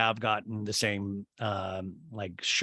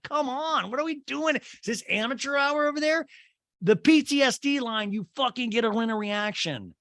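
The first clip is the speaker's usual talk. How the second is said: exasperated rant; 11 semitones higher than usual. Real, not acted. Ranting at show editors.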